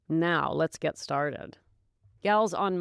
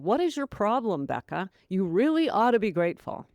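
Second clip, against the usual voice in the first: harsh inner critic. Retold or imitated. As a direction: berate yourself like a nagging inner voice. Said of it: "that voice in the mind that says".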